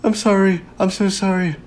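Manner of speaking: high-pitched imitation of a tearful apology; mock bawling. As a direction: wail the apology as the crying server. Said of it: "she's bawling, and she's saying".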